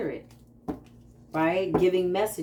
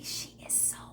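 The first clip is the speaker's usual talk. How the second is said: hushed, much softer, in awe; awed whisper; 18 dB quieter than usual. Retold or imitated. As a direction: whisper it as if in reverence. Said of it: "I'm like".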